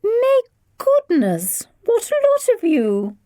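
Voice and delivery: very high, slow society lady; a grand visitor surveying the hall; posh guest murmur. A narrator's reading. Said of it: "Missus Bingley murmured".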